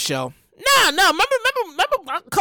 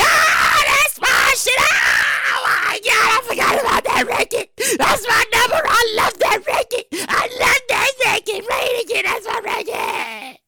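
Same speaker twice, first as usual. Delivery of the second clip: excited crowd shouting; 10 semitones higher, slower than usual. Pretend. Imitating a concert crowd, high excited shouts. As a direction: yell it like a fan who just heard their song.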